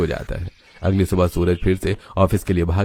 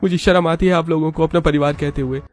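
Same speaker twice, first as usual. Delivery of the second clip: high-pitched bitter accusation; angry son; 12 semitones higher, 3 dB louder than usual. Acted. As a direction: confront the family one by one. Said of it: "सूरज गुस्से में".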